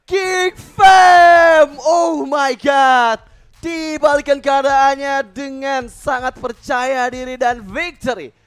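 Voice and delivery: huge drawn-out victory yell; fighting game announcer. Real, not acted. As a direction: belt out the win like an arena announcer. Caster calls the game win. (teriakan penyiar kemenangan)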